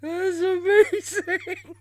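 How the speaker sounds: slow, high laughing cry; crying with laughter. Real, not acted. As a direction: wail it out in helpless laughter. Overcome with laughter.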